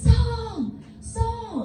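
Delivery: high, sung-out call across a distance. Retold or imitated. David calling after King Saul from the cave, high sung call. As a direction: call his name after the departing king.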